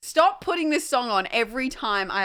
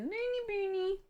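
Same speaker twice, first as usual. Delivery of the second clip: pet-name cooing; 10 semitones higher, 7 dB quieter than usual. Real, not acted. Streamer calling her dog by pet name, high cooing. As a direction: coo it high and quietly to the dog.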